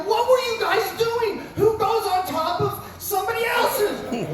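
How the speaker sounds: very high, slow incredulous cry; can't believe what they did; outraged disbelief. Retold or imitated. Coach to the boys at the overflowing stall: very high, slow disbelief. Coach seeing the clogged toilet.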